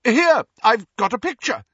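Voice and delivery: high, loud offer; shout. A narrator's reading. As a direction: call it out too eagerly. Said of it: "Lupin shouted".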